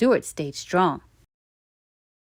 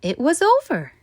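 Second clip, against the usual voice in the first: ordinary delivery, nothing marked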